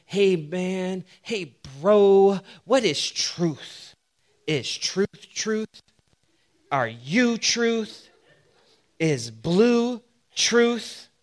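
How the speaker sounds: slow, soft, spaced-out stoner musing. Retold or imitated. Preacher mocking a stoned guy's musing, slow and dreamy. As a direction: drift through it like a stoned philosopher. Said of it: "some guy just, like, tripping out thinking".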